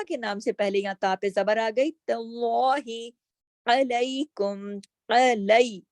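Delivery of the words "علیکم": علیکم with 'ai' as in کئی; ya leen. Shown as a demonstration. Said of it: "یہاں پہ یا لین آ گیا". (علیکم میں یا لین، کئی کی طرح)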